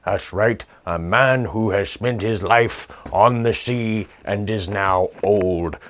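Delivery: ordinary talk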